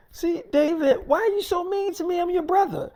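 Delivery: very high, fast whining; whiny Lucifer voice. Retold or imitated. Lucifer whining to his brother, in a very high voice. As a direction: whine up high like a hurt sibling.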